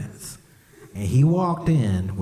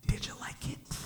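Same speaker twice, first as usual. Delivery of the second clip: hushed, creepy little question; sly child whisper; 13 dB quieter than usual. Retold or imitated. Son sneakily asks about watermelon.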